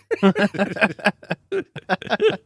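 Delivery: long, unfamiliar-sounding laughing fit; real laugh in a strange new style; odd new laugh. Real, not acted. Host cracking up at the clothes soup joke, odd new laugh. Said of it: "Did you get a new laugh?".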